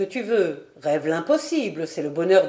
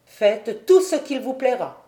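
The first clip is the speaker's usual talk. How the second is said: raised, louder cold retort; icy tone; 5 semitones higher, 5 dB louder than usual. A narrator's reading. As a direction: coldly dismiss your wife. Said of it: "reprit Flochardé d'un ton glacial". (ton glacial)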